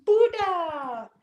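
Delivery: high, drawn-out coo of delight; delighted greeting. Real, not acted. Cooing over a friend's dog Buddha, delighted high greeting. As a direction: greet a beloved dog in a high, falling singsong.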